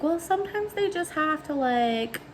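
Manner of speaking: high-pitched, tentative mom voice; hedging mom. Retold or imitated. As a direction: imitate a mom gently hedging.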